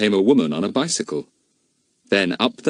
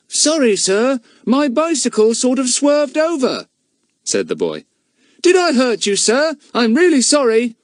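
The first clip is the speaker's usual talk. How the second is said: put-on high, boyish, eager-to-please voice; apologetic boy; 5 dB louder than usual. A narrator's reading. Telegraph boy apologises to the policeman, boyish and eager. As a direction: apologise like a cheeky errand boy.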